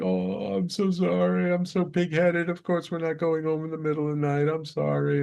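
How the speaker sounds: high mock-weepy apology, gradually easing down; imitated tearful wife. Bawling wife apologising to her husband, imitated weepy. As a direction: play her remorse high and drawn from bawling. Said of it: "she's just bawling all night long".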